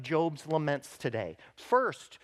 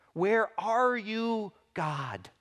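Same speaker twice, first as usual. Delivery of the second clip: pained cry; 4 semitones higher, much slower than usual. Retold or imitated. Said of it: "You can hear Job's pain".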